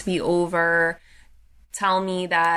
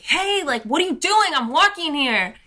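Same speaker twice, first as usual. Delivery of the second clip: high-pitched retold outburst at a driver; mock yell at a careless driver, high and swooping; indignant yell; 9 semitones higher than usual. Retold yell at car nearly hitting her.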